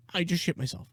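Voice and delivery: quiet little confession in character; mock audience lady.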